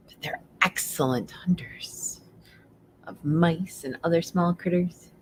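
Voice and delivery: hushed whisper; hushed owl fact for the children. Acted. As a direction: whisper it like a secret.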